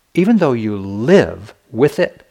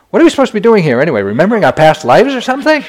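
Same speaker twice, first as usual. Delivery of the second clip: loud, fast, higher exasperated outburst; rushed, loud, exasperated blurt; 10 semitones higher, 11 dB louder, much faster than usual. Retold or imitated. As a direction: blurt it out in a rush of frustration. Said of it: "So I blurted out to U Pandita".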